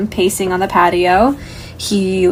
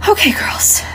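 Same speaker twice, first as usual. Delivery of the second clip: whisper; 12 semitones higher than usual. Acted. Mom whispering after deep breath.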